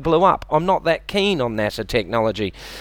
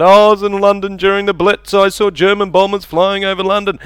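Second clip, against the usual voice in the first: put-on higher, louder old-timer boast; a pensioner's boast about the Blitz; old Londoner; 5 semitones higher, 4 dB louder than usual. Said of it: "old people ... bang on about".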